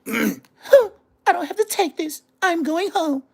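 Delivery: mocking a rival as a high, crying diva; mocking whiny diva. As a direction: whine it like a hurt diva storming off. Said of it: "The bitches gonna go in their room".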